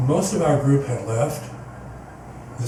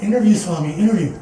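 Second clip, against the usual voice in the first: higher, louder eager pleading; eager plea; 5 semitones higher, 4 dB louder, faster than usual. Retold or imitated. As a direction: beg him, rising with hope. Begging Swami again.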